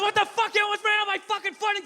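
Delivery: high, rapid-fire outraged yell; imitated angry screaming. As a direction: act out an irate man screaming over excuses. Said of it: "He's screaming at me".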